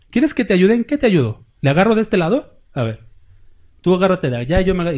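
God offering help in a lifted, friendly voice; voicing God. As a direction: play a patient father asking how to help. Speaker voices God answering.

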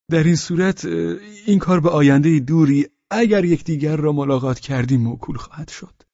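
high, flustered character voice; Pavel Pavlovich. Acted. (صدای زیر و دستپاچه شخصیت)

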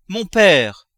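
loud, high cry of protest. Acted. Daughter to her father over the treasure, protesting cry. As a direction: call out to stop him.

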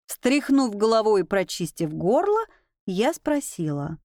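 usual speaking voice

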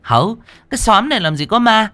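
high-pitched, dismissive scolding; scolding landlady. A narrator's reading. Landlady orders tenants back to bed, shrewish high scolding. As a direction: tell them all off impatiently.

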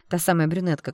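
ordinary delivery

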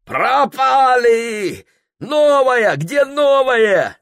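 high, slow frantic yelling; panicked shout. Acted. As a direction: yell for the spare oar. Oar breaks in the rapids. (панический крик)

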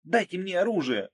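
high, urgent begging; pleading. A narrator's reading. Margo begging for a gun under fire, pleading. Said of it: "умоляла Марго".

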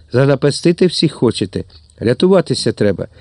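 plain reading voice

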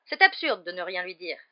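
loud, exasperated protest; exclaiming. A narrator's reading. Father arguing with his wife over Harry, exclaiming. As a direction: burst out in disagreement. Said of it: "s'exclamait monsieur Weasley".

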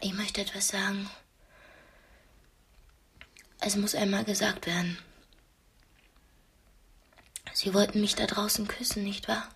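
hushed, breathy whisper, barely voiced; a tense, secret whisper at the tea table; whispered confession. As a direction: whisper it so only he can hear. Confiding in her captor over tea.

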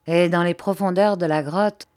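ordinary delivery, nothing marked

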